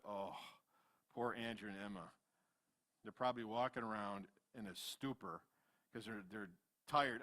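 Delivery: low, soft pitying murmur; quiet sympathy for worn-out parents; sympathetic hushed thought. Retold or imitated. Voices pitying thought for tired parents.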